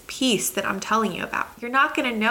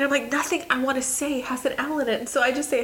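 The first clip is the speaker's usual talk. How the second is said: ordinary delivery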